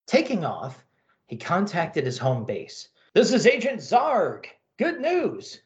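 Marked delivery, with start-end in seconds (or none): 3.1-5.6 alien agent, upbeat report; cheerful alien agent. Acted. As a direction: report home, pleased.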